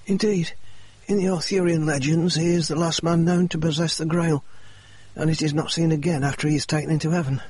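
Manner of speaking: lightly voiced Murray, pressing on; injured man's voice.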